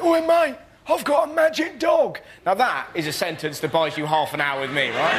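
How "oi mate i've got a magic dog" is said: homeless bloke calling out. Retold or imitated. Homeless man calling to a passer-by, mock shout. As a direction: call after him like a chancer with a secret. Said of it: "he went".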